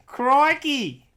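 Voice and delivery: high, loud hammed-up Aussie; shouted mock Australian, very high; put-on Aussie accent. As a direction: exclaim as a broad Australian bloke. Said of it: "I'll be Aussie".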